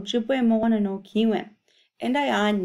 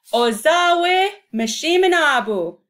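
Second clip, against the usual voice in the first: shouted high and loud, a bit slower; 8 semitones higher, 8 dB louder than usual. Shown as a demonstration. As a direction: call the whole phrase out loudly. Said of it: "we're gonna shout it".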